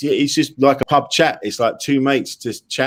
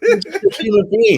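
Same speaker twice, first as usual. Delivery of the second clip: laughing; 9 semitones higher than usual. Real, not acted. Host laughing at the impression.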